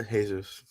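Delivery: "Jesus" as Spanish "hay-soos". Real, not acted. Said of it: "You say Jesus?".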